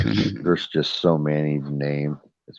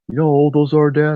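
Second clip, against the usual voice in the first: high, loud mock disbelief from the kid; a kid stunned at how old something is; incredulous son; 12 semitones higher, 6 dB louder than usual. Son teasing Dad about how old cassettes are, loud and high in disbelief.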